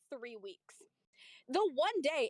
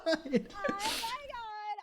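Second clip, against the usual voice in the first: drawn-out exclaim; 8 semitones higher, 5 dB louder, much slower than usual. Retold or imitated. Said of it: "And I went".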